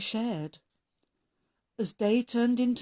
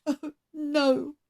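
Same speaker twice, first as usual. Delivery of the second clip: higher, mildly tearful sigh; a touch of tearful dread; 7 semitones higher, 3 dB louder than usual. A narrator's reading. Scarecrow dreading singing, mildly tearful. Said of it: "Stick thought tearfully".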